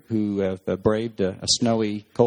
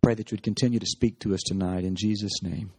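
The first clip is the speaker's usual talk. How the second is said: prayer. Acted. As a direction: pray it unhurried, as to God. Pastor leads closing prayer.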